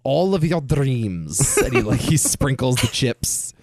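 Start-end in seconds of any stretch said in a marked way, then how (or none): 0.0-1.6 put-on slow, hammy Russian accent; over-the-top Russian accent. Co-host imitating a poker-film villain, slow thick Russian accent. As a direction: draw it out as a bad movie Russian. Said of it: "does this very over-the-top Russian accent".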